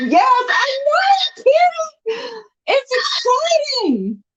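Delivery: very high squealing to the cat; excited squeal. Real, not acted. Owner squeals to her excited cat, very high.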